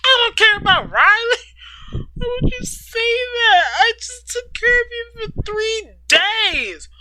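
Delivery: high, loud angry outburst; angry shout. Acted. Katie to Ryder, high and loud yelling. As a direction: shout it in hurt disbelief.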